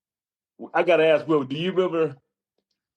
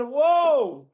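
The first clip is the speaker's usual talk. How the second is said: retold whoa; 9 semitones higher than usual. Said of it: "I said".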